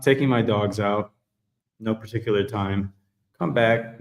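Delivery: usual speaking voice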